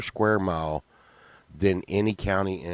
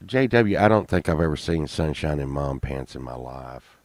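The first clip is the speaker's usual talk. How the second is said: ordinary talk, nothing marked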